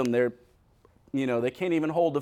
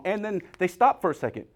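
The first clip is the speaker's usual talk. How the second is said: normal talking voice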